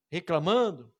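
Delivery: ordinary talk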